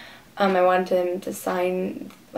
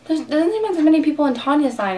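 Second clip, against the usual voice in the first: higher, louder put-on friend voice; imitated friend; 7 semitones higher, 5 dB louder than usual. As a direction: quote an eager friend pointing something out. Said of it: "my friend's like".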